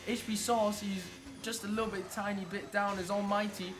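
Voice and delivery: excited sim racing commentator calling the last qualifying laps; live play-by-play. Real, not acted. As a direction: call every lap like a finale.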